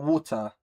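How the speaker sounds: "water" said with its T. Shown as a demonstration. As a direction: keep the T in "water". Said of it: "That's how you say it".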